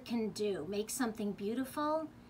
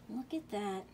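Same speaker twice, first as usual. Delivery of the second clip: softly gasped aside, full of wonder; hushed wonder; 5 dB quieter than usual. Acted. Reader showing children the web, hushed wonder. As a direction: draw the listeners in quietly.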